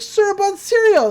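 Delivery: put-on high, loud girlish exclamation; excited Mabel imitation. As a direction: blurt it out with mock glee. Mabel excited about breakfast.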